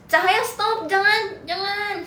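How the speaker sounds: high, drawn-out mock wail; mock pleading. Streamer begging the creeping light to stop, mock pleading. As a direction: beg it to stop, high and whiny-long.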